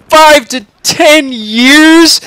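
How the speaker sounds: high, loud, drawn-out disbelief; incredulous exclamation. A narrator's reading. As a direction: echo it back in outraged shock. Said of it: "exclaims Charlie".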